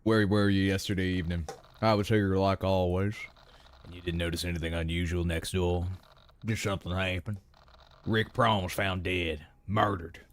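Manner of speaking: very low interrogation voice; low, slowing murder-case questioning; detective role. Acted. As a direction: question the witness in a deep, measured detective voice.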